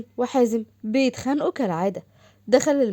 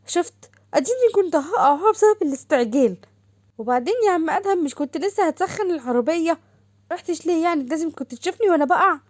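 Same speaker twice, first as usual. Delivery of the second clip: higher, bright-eyed teasing sister voice, a bit louder; teasing younger sister; 7 semitones higher, 4 dB louder than usual. Acted. Sister teasing Adham about nearly falling, high cheeky voice. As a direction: tease your big brother in a high, cheeky voice. لتقوم تقول لأدهم.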